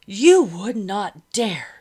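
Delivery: loud, slow, outraged challenge; affronted defiance. A narrator's reading. Madam defying the officer's threat to move her, outraged. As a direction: dare him, drawing out each word.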